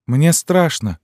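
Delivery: put-on high, loud scared child; frightened child cry. A narrator's reading. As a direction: cry out like a frightened little one. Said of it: "крикнул другой".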